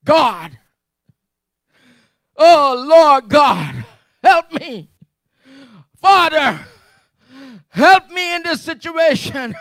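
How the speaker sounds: loud, high pleading cry to God; demonstrated desperate prayer. Shown as a demonstration. Preacher acts out Paul's pleading prayer, loud and high. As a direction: shout the prayer like a desperate believer begging. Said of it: "let me see if I can demonstrate it".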